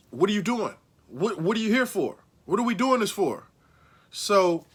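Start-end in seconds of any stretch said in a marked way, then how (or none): none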